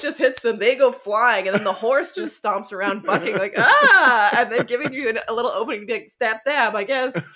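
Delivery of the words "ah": laughing mock yell. Bucking horse mock cry.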